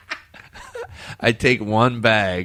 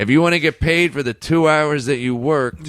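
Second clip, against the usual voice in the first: boss calling out; 8 semitones higher than usual. Acted. Boss calling after quitting worker.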